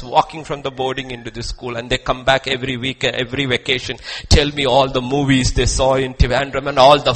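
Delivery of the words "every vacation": normal talking voice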